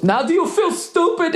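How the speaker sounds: imitating the driver's snide gotcha; smug taunt. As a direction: gloat like the smug rich driver. Retold quarrel, driver's taunt again.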